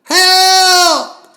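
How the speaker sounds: very high, very loud cries for help; desperate screaming. Acted. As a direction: scream for help with everything you have.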